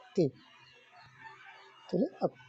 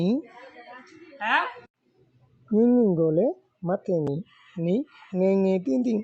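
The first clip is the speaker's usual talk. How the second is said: lesson recitation; 3 semitones higher, 3 dB louder than usual. Shown as a demonstration. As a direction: call out each phrase like a teacher leading recitation. (بلند آواز میں سبق پڑھانا)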